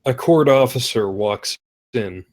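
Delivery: normal talking voice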